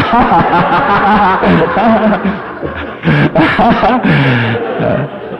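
long, helpless laughing fit; real laughter. Real, not acted. Preacher turns to the men, long real laughter. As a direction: keep laughing, unable to go on.

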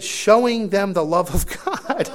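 loud, high, drawn-out title in comic disbelief; mock-incredulous reading. Preacher revealing the rude man's book title, mock-incredulous. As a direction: blare out each word slowly, highly ironic.

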